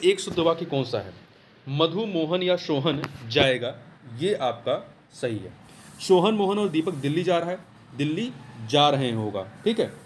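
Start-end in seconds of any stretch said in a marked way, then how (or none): none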